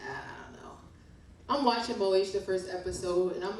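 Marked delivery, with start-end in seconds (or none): 0.1-0.7 whispered aside. Real, not acted.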